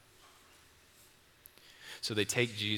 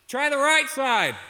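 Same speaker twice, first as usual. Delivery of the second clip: high, loud, slow teasing call; smirking Jesus; 12 dB louder than usual. Acted. Jesus calling to the fishermen with a smirk, loud and slow. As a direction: stretch it out with a knowing smirk. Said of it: "the smirk on Jesus' face".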